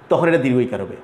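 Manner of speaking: ordinary delivery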